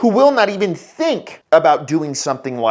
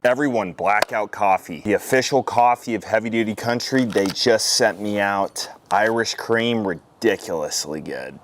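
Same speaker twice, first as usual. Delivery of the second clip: sponsor ad read; 4 semitones lower than usual. Acted. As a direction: pitch the coffee like a relaxed sponsor spot.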